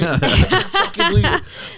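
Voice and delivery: laughing through the words, high and slow; can barely get it out for laughing. Real, not acted. Laughing at his own blunder.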